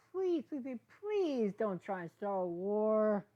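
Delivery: high, loud, slow begging; pleading. Acted. Hitz begs Sprout not to start a war, pleading. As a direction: drag out each please, begging.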